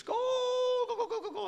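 giddy mock commentator call; British commentator. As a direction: cheer like a commentator losing it. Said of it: "I love the British commentators".

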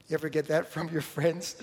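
usual speaking voice

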